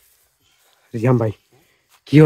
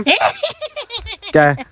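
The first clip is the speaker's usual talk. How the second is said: eerie laugh; 6 dB quieter than usual. Pretend. Strange voice answers.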